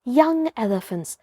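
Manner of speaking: ordinary delivery, nothing marked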